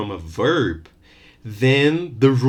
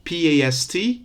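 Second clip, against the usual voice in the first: ordinary talk, nothing marked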